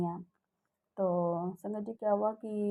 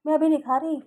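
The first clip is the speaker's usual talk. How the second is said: loud, higher snapped refusal; sister-in-law's sharp refusal; 6 semitones higher, 10 dB louder than usual. Retold or imitated. Sister-in-law refusing the food, loud and sharp. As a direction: throw the refusal back loudly. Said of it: "ऐसा बोली".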